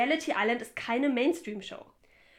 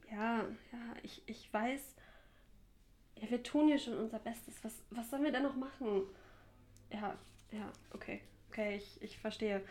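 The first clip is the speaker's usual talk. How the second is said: hushed, hesitant phone reply; cowed whisper; 10 dB quieter than usual. Acted. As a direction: shrink into a near-whisper as the boss scolds. Boss scolds her over phone. (eingeschüchtert geflüstert am Telefon)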